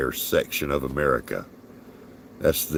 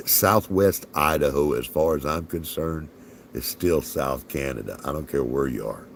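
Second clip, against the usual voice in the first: ordinary delivery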